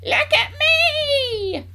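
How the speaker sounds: very high, long-drawn screech; rooftop shout. A narrator's reading. Grandma demanding attention from the rooftop, shouting. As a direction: screech it loud and stretch the last word. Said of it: "Grandma shouted from the rooftop".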